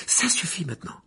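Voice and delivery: high, indignant interruption; exasperated exclamation. A narrator's reading. Hermione interrupting the professor's doom prophecy, exasperated. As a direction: cut in, fed up. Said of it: "s'exclama Hermione".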